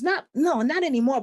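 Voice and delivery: normal talking voice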